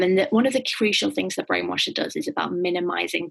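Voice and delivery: normal talking voice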